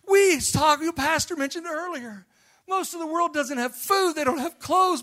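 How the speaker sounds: loud, high, impassioned preaching shout; rousing revival preacher at the peak; preaching at full force. Acted. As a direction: lift the congregation with a fiery cry. Preacher building to climax.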